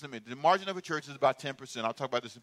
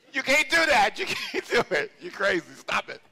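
laughing scold; 6 semitones higher than usual. Retold or imitated. Wife laughing at her husband's piddling, high laughing scold. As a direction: scold him through a laugh.

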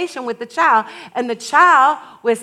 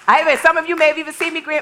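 ordinary delivery

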